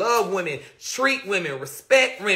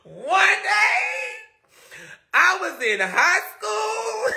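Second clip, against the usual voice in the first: high and slowed, laughter breaking through; laughing shout; 9 semitones higher, much slower than usual. Real, not acted. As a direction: barely get the words out for laughing. Laughing while starting the climax.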